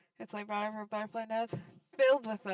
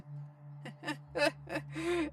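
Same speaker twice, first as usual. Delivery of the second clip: evil laugh; 7 semitones higher than usual. Acted. Karen gloating over the limbless Gregor, evil cackle. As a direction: cackle over the helpless victim.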